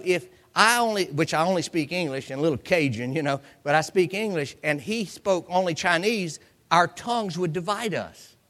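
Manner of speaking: usual speaking voice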